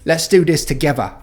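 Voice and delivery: Cockney demo, turn the TH in "together" into a V. Shown as a demonstration. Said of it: "pronounce it as a V".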